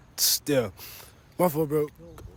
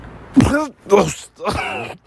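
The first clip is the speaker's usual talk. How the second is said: fake cough; 8 semitones higher than usual. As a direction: cough right at them. Prank cough at stranger.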